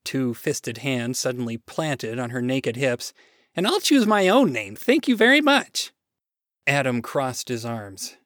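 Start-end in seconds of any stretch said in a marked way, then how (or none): 3.5-5.9 high, loud, sassy defiance; indignant defiance. Acted. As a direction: stand your ground indignantly. Woman protests, hands on hips.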